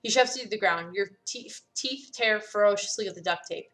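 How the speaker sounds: ordinary talk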